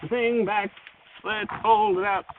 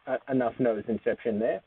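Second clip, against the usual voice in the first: normal talking voice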